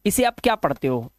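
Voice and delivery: normal talking voice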